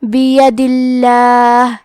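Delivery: slow بید اللہ, all three vowels clear and a thin ل; thin lam. Shown as a demonstration. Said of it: "حرف اللام کو پتلی آواز میں پڑھنا ہے".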